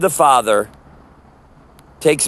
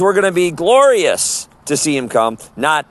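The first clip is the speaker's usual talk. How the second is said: ordinary delivery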